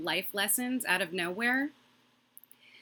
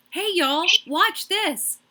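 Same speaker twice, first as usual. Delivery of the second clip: hollering before a stunt; hillbilly yell; 9 semitones higher, 7 dB louder, slower than usual. Retold or imitated. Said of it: "I have yelled the words".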